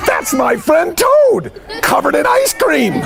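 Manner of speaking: high, drawn-out astonishment; wide-eyed storybook revelation; amazed realization. Acted. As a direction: announce it high and slow, astounded. Frog recognizes Toad.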